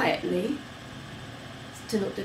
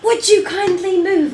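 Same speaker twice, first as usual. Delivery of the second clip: high, loud mock-polite yell; mock scream; 9 semitones higher, 6 dB louder than usual. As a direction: scream the polite request, higher and louder. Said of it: "and screamed".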